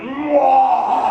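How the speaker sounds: mock loud mating grunt; hammed-up turtle noise; tortoise grunt. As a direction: let out a comic animal grunt.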